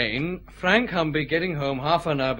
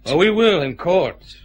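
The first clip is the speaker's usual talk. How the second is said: confident retort; 4 semitones higher, 8 dB louder than usual. Acted.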